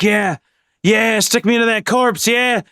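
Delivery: high, gleeful put-on voice of a bloodthirsty knife; eager knife voice. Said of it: "your knife, like, really wants to stab people".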